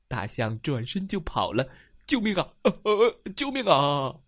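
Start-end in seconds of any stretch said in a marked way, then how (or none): 2.1-4.2 loud panicked wailing cries; frightened elephant wailing; elephant wailing for help. Acted. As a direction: whimper and wail as you run. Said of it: "大象发出了哀鸣".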